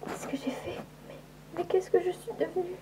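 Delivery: souffle à peine audible; chuchoté. Acted. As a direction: murmure l'horreur de ce qu'elle est. Ordre hypnotique puis horreur chuchotée. (chuchotement effaré)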